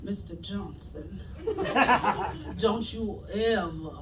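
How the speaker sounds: low, hushed, very slow dramatic delivery; grave grandmother drawing out a warning; theatrical slow-burn threat, deep and soft; Viola Davis monologue moment. Retold or imitated. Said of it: "in her full Viola Davis Fences monologue moment".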